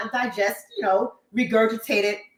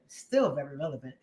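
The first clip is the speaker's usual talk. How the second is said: muttered aside; 9 semitones lower, 7 dB quieter than usual. Real, not acted. Muttered emphasis.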